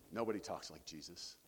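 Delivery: usual speaking voice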